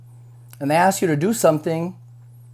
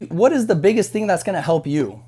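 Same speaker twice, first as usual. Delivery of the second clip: ordinary delivery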